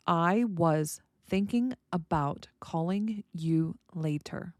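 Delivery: slow, separated words with little tune. Shown as a demonstration. Teacher giving learners an example sentence, word by word. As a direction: read it out one word at a time, unblended. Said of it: "The blending shows it's natural".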